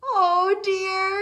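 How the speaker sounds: high, loud cry of alarm; cried out. Acted. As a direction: cry out as the water rises. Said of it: "he cried".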